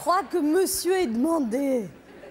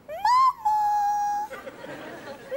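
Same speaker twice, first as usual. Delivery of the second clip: high distant calls for mum; child calling; much faster than usual. Acted. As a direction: call out from another room like a child. (appel d'enfant au loin)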